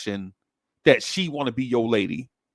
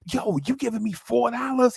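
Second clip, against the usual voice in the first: put-on surprised kid; 11 semitones higher than usual. Kid surprised at uncle's money.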